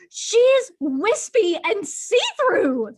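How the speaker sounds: mock indignant; mock exasperated point. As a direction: spell it out for dim Byron. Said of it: "It's like".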